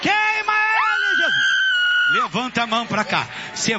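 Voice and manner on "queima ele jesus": high drawn-out exorcism shout; preach. Real, not acted. Preacher to Jesus against the demon, full-force shouting. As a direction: cry out at full force, stretching the vowels.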